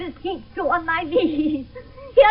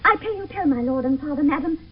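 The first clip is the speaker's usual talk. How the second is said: usual speaking voice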